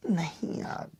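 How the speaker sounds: soft, whispered no; acted whispery brush-off; hushed denial. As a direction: play a meek denial almost under the breath. Evasive reply to "is there someone?.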